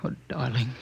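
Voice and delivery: whispered comfort; tender whisper. Acted. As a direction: breathe it to her gently. Comforting his frightened wife.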